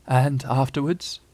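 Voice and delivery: slow, husky, hesitant question. A narrator's reading. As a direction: manage it huskily after struggling to speak. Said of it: "very huskily".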